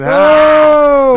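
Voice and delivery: a loud, high, startled "Oh!"; surprised shout. Real, not acted. Cohost reacting to the joke pick: startled shout.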